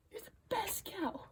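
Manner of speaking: soft breathy whisper of praise. Real, not acted. To her cow, tender whisper.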